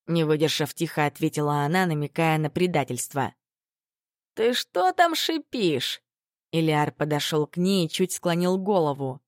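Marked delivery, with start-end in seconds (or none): 4.3-6.0 high, slow mocking sneer. A narrator's reading. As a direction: drag it out, taunting. Bully confronts her.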